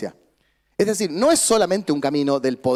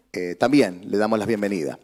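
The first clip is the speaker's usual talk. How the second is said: usual speaking voice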